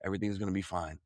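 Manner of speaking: put-on soft and low, a mother rocking her baby; hushed tender comfort spoken as a loving spirit; soothing motherly voice. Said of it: "this warm, smooth, slow, relaxing, loving presence".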